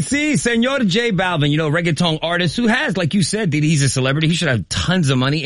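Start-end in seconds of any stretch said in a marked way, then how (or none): 0.0-1.5 mock Spanish. Playful Spanish greeting.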